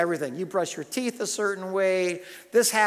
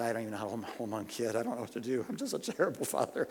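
soft, low murmur of despair; hushed, helpless self-reproach, lightly acted; retold tearful confession; 6 semitones lower, 8 dB quieter, faster than usual. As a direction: imitate a weeping, defeated new father quietly. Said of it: "I just start weeping".

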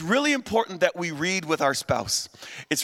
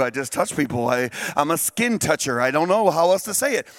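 much lower, lazy casual dude; laid-back dude voice; 6 semitones lower than usual. Retold or imitated. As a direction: shrug it off like a slacker. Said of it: "then they would have said".

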